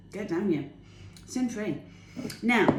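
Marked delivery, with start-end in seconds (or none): none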